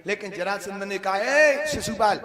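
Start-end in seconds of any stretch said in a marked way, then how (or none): 1.2-2.2 loud, drawn-out hail, pitch raised; stretched, higher storyteller's shout; calling out. Acted. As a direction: call him over sharply from afar. Acted calling out to Shishupal.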